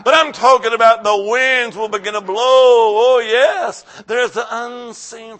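loud, rising preacher's shout; full-force preaching. Real, not acted.

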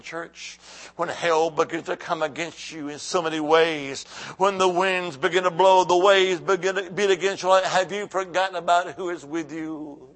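normal talking voice

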